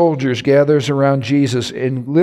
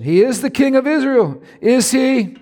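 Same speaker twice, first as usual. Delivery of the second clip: much higher sarcastic sneer; mocking; 11 semitones higher than usual. Priests taunting the crucified Jesus, high sneer. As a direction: jeer at him, rising in scorn. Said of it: "Look at this mock again".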